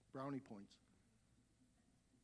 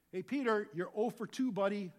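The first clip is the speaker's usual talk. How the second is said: louder and higher, chiding Peter; mock scolding; 5 semitones higher, 9 dB louder than usual. Preacher scolding Peter for two denials, louder. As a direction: call Peter out like a buddy scolding. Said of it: "You think".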